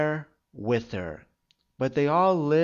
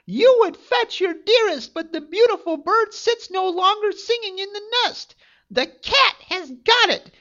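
high, loud, sneering enchantress taunting the prince; venomous witch jeering from the tower, high and loud; mocking witch voice; 6 dB louder than usual. A narrator's reading. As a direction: gloat over him like a wicked witch, pitched way up. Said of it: "she cried mockingly".

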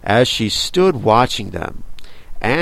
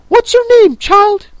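imperious Queen; 8 dB louder than usual. Acted. Queen voice demands name.

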